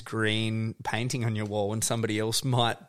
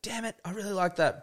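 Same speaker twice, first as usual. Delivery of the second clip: much higher whiny protest; reluctant creative; 11 semitones higher than usual. Retold or imitated. Creative told to change their work, mock whine. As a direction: whine that you liked it.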